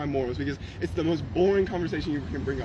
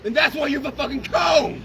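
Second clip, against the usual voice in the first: furious shout; 8 semitones higher, 5 dB louder than usual. Real, not acted. Yelling that people don't want to hear him, furious. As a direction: hammer every word in rage. Heated argument with preacher.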